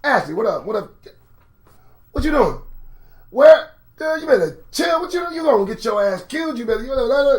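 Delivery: high, pleading warning; protective friend. Pretend. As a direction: talk her out of it, urgent. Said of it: "Hebrew friends would have been handy".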